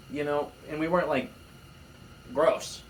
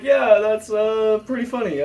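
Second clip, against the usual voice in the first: amused reply; 9 semitones higher, 9 dB louder than usual. Retold or imitated. Retelling reply to friend.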